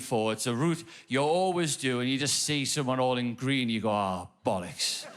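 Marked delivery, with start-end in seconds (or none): 3.9-5.1 low, slow sinking groan of words; mock dread, dragged out; weary dismay. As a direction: let the heart sink, low and slow. Dreading being helped across road.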